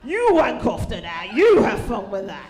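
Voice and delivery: loud, slow, taunting send-off; mock loud taunt. Comic to an imagined hair fan, loud slow taunt. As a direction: shout it slowly at the wig-toucher.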